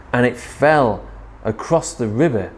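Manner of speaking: usual speaking voice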